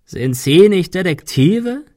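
slow, loud croaked question; croaking. A narrator's reading. Claymore taunts the detectives out of the fog, croaking. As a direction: croak it mockingly, rising high. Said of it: "krächzte er".

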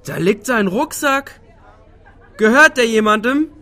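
high, slow staged alarm; fake alarmed bystander. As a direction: play the worried passer-by loudly. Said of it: "und wir sehen uns nachher".

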